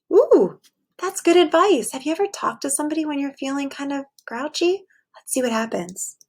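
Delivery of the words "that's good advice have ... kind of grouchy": ordinary delivery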